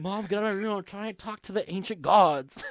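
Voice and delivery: high, loud pretend complaint to mom; mock teen yell. Playing a kid seeking the gods, mock teen yell. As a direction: yell it like a put-out kid, hammed-up.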